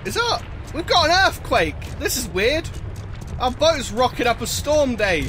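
startled, loud and high; suddenly alarmed by the quake; surprised. Real, not acted. Sudden earthquake in the game.

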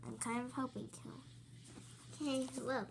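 ordinary talk

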